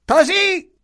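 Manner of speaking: very high, sharp order; silencing the servant. A narrator's reading. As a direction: cut her off in a piercing high voice.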